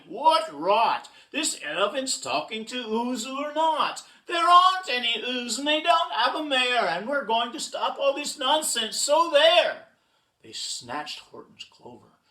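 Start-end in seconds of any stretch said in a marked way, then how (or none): 0.1-9.9 high, loud bullying monkeys; blustering cartoon monkey rant; shouting. A narrator's reading. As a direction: shout it down scornfully. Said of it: "came shouting".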